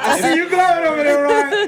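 high, laughing teasing call-out; gleeful teasing. Real, not acted. Teasing Ryan again about going over there, gleeful. Repeats the tease, livelier.

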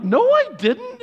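high, loud, drawn-out mock protest; protesting denial. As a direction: imitate a dad loudly defending himself. Dad denying daughter's accusation.

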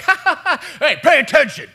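high, loud mock laugh flipping into a bark; laughing teacher snaps. Teacher joking with the class, then abruptly stern, mock laughing. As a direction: laugh then suddenly demand attention. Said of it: "a switch would flip inside of him".

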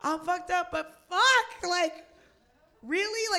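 high, loud exasperated disbelief; rising outrage at the whole thing. Retold or imitated. Comic venting at the drunk fan, in high, loud disbelief. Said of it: "And I'm like".

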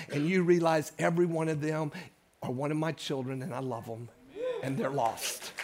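Holy Spirit gently reassuring the anxious pastor, soft and low; tender divine voice. Retold or imitated. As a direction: speak softly as God comforting a nervous preacher. Holy Spirit speaks to him.

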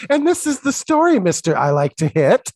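high, loud chirpy accusation; imitating Chelsea. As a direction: chirp it out brightly. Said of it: "she's like".

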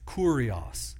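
"kurios" slow, KOO-ree-os, stress first; Greek kurios. Shown as a demonstration. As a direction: sound out "kurios" like curious but rounder. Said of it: "kinda sounds like our English word curious".

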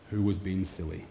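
quiet, level retelling of a cool pastor; calm and cool. Retold or imitated. Pastor calmly asking the boys who broke the cups, quiet and cool. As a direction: keep it soft and controlled, no anger. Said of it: "he just said very calmly, quite coolly".

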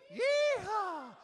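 high, full cowboy whoop; yeehaw shout. Shown as a demonstration. Leader whooping to get the congregation joining in, high shout. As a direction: let the yeehaw ring out high. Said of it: "Everybody, come on".

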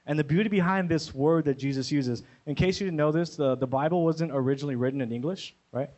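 usual speaking voice

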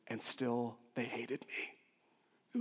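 slow near-whisper, drained of force; soft, halting disbelief; hushed, wounded Jesus. Acted. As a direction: say it slowly, almost under your breath. Voices Jesus's hurt line.

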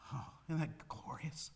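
hushed, breathless wonder; awed whisper. Real, not acted. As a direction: whisper it in awe to the congregation. Awed aside during reading.

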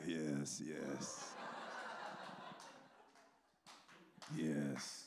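low, soft, laid-back approval; Sorpresa. Retold or imitated. Imitating the onlooker quietly approving his partner, low and soft. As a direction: murmur it deep and unhurried, long gaps between. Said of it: "Sorpresa is just like".

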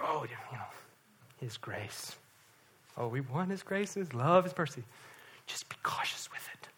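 hushed, timid imitation of lukewarm praise; mock cautious praise. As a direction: mockingly murmur it, half-whispering the last words. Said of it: "we wouldn't talk like that".

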